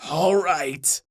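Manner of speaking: low, sighed agreement; resigned sigh. A narrator's reading. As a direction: give in with a sigh, pitch dropping. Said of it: "Bothersnatch sighed".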